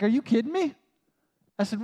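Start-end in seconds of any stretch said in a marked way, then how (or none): 0.0-0.7 higher, indignant retort; retelling being fed up; ticked outburst. Retold or imitated. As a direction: snap back in disbelief. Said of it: "I was ticked".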